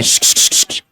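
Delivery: repeated sharp shushes; shushing. Real, not acted. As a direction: hush the class with a string of shushes. Hushing a noisy class. (श्श करके चुप कराना)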